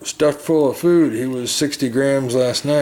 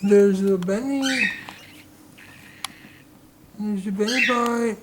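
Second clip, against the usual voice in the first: high singsong coo; baby talk; 11 semitones higher than usual. Acted. Owner cooing at her chirping baby bird, high singsong. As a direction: dote on the chick in a high voice.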